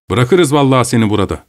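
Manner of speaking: plain reading voice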